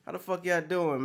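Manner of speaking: ordinary delivery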